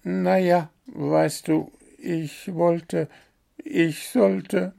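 high, hesitant little bird voice; the bird squirms before the gnome, sheepish; sheepish hemming. A narrator's reading. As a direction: stammer out excuses, caught out. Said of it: "druckste der zerknirscht herum".

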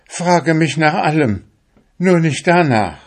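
high, loud desperate witch plea; pleading witch. Acted. Witch begs the fisherman not to ask.

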